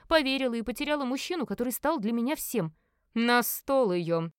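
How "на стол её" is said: slow, imperious order; commanding. Acted. Merlin orders the captive onto the table, slow command. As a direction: give a drawn-out villain's command. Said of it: "скомандовал Мерлин".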